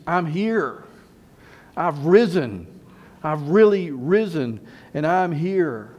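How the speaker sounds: strong, spaced-out proclamation; emphatic announcement. Acted. Jesus appearing to the disciples, emphatic announcement. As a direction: announce it with weight on each key word.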